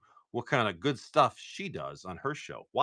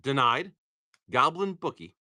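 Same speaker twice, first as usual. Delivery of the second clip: ordinary talk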